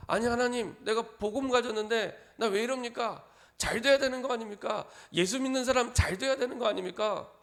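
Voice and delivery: lightly voiced whiny complaint; mock complaining believer. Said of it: "하나님께 따지는 겁니다". (하나님께 따지는 신자의 가벼운 불평조)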